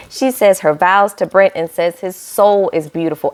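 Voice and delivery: ordinary talk, nothing marked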